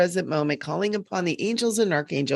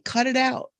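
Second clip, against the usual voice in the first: firm directive; 6 semitones higher than usual. Real, not acted. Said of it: "one of the few directives".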